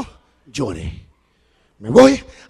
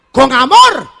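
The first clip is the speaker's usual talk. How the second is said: loud punchline; 5 semitones higher, 10 dB louder, slower than usual. Shown as a demonstration. Ironic punchline after spanking joke.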